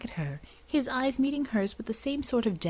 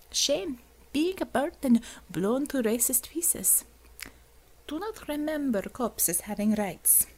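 mocking Russian accent; 5 semitones higher than usual. Commissar mocks prisoner.

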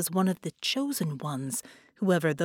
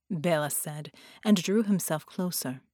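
ordinary delivery, nothing marked

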